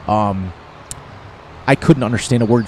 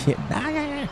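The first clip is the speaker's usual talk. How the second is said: put-on high, soft Scottish-accented "Yeah, nah, yeah, yeah"; a jokey Scottish accent, pitched way up and soft; mock Scottish wife; 9 dB quieter than usual. Husband mimicking his Scottish wife, Scottish accent. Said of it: "she's like".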